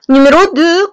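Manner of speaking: item number called out loudly; loud heading. Real, not acted. Teacher to class, loudly announcing the next item. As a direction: call out the number with force.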